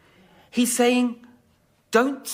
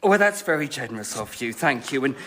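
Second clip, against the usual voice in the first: much lower, quick oily thanks; smug acceptance; 10 semitones lower than usual. Acted. Medium greedily accepting the books, much lower and smug. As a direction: pocket the prize smoothly.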